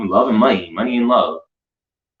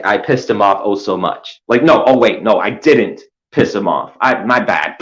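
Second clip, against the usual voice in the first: ordinary delivery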